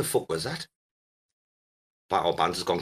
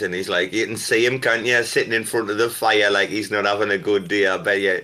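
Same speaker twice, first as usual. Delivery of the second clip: normal talking voice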